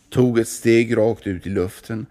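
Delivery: usual speaking voice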